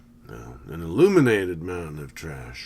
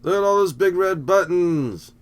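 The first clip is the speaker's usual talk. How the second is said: excited exclamation; 11 semitones higher, 8 dB louder than usual. Real, not acted. Excited reaction to movie scene.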